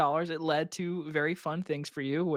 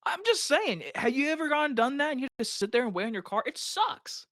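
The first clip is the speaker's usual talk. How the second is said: high-pitched, hurried self-justification; defensive protest; 8 semitones higher than usual. Real, not acted. Teased friend defending a complaint about waiting at the dealership, defensive. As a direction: protest your case in a raised pitch, rushing through it.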